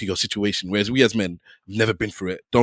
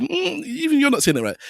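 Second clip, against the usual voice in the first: much higher, sneering imitation of the critic; mocking the critic. Imitating the man who said he pronounces his name wrong. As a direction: mimic the man correcting him, pitched way up.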